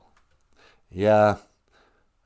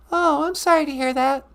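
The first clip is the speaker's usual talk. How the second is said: put-on very high, loud little-girl voice; squeaky girl caller; 8 dB louder than usual. Caller [name] greeting Bowie in a very high girlish voice. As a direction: chirp a hello like an excited kid.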